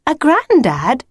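put-on high, simple girl's voice; Lucy explaining. A narrator's reading. Lucy defining granddad for the wolf, explaining. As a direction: state the word clearly, pitched high. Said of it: "replied Lucy".

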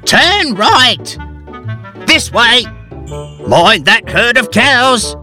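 high, called-out directions; a mild mock shout steering the bike. A narrator's reading. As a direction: shout from the back of the tandem. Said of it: "shouting directions".

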